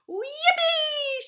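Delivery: high, loud whoop; shouted. A narrator's reading. Grandpa Joe whooping as they rocket up. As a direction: let out a joyous whoop. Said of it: "shouted Grandpa Joe again".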